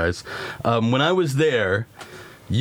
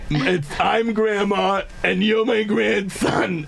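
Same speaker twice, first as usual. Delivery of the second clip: grandma voice; 9 semitones higher than usual. Acted. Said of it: "doing my own grandma voice".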